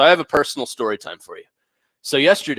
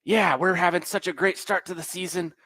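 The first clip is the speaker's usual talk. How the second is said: higher-pitched imitation of an overconfident fan; mock nerd voice; 6 semitones higher than usual. Nerd boasting about his team to strangers, mock nerd voice. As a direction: mimic a clueless fan, voice pitched up. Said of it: "He goes".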